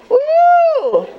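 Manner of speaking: whooping. Pretend. Letting her inner city child out, whooping high. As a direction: let out a wild whoop.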